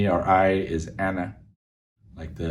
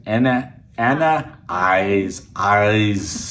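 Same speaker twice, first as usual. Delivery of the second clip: slow, heavy Austrian accent like Arnold; Arnold Schwarzenegger accent; 3 dB louder, much slower than usual. Shown as a demonstration. Learner demos Arnold accent for Arabic phrase. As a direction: growl "Ana eyes" like the Terminator. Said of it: "Remember the Arnold Schwarzenegger accent".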